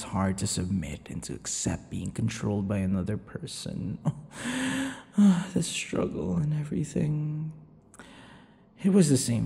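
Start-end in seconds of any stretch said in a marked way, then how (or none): 4.0-5.5 short high chuckle; high amused laugh. Acted. Vampire amused at the captive's struggle, light laugh.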